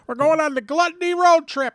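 loud, high and thrilled; excited shout. Real, not acted. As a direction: cheer it out.

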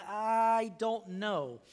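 slow, drawn-out hesitant "I don't know"; unsure. Retold or imitated. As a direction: let "I don't know" stretch out slowly in doubt. Imagined doubter in crisis.

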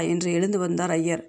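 ordinary delivery, nothing marked

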